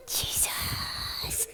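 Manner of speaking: exaggerated breathy puppet whisper; barely audible stage whisper. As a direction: whisper it, drawing the name out. Hushed retake after being too loud.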